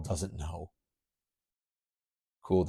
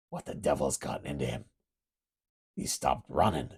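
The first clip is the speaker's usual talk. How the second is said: low beastly voice; 6 semitones lower than usual. Acted. Lycos tracks his prey, low beastly voice. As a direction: speak like a hunting wolf-man.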